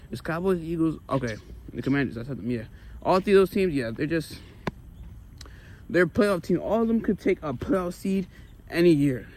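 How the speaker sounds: ordinary talk, nothing marked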